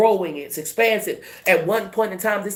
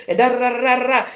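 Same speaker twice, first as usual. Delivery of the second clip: raised, louder run of nonsense syllables; mock rambling; 6 semitones higher, 4 dB louder, faster than usual. Reader imitates someone justifying old habits, mock rambling. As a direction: rattle off the filler in a lifted voice.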